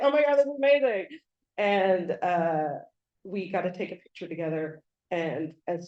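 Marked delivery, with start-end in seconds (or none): 0.0-1.1 high, fast, delighted burst; delighted exclamation. Retold or imitated. Actor admiring her tattoo, high delighted exclamation. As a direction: react like a thrilled star seeing a fan tattoo. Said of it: "and he was like".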